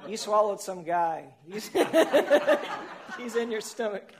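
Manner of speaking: can't keep a straight face; laughing through line. A narrator's reading. Reader cracking up voicing the crab doctor, laughing.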